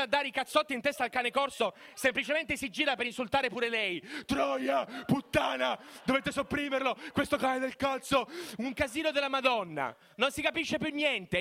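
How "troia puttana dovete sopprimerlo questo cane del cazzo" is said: angry crying. Retold or imitated. Ex insults the owner.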